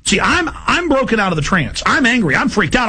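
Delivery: usual speaking voice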